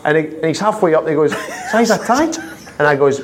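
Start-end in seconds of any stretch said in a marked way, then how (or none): 1.7-2.2 high-pitched retold question with a rising end; questioning call-out. Friend halfway up the stairs asks, calling out. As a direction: imitate a friend checking, pitched up.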